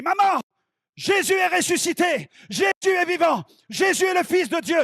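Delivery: very high, loud triumphant shouting; yelling. Retold or imitated. Son bursting into his mother's séance, yelling triumphantly. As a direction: burst in and holler it at full force. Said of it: "j'interromps la séance et je hurle".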